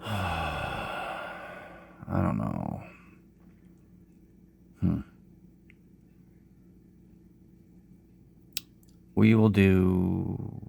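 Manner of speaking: tired, low, slow sick voice; low unhurried voice. Real, not acted. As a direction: drag it out like you have a cold. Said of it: "if I sound low energy".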